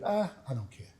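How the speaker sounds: ordinary talk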